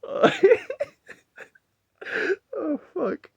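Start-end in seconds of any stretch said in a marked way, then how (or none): 2.6-3.3 high, breathless with laughter; laughing. Real, not acted. Recovering from his laughing fit, still giggling. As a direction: still cracking up. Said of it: "I just find that funny".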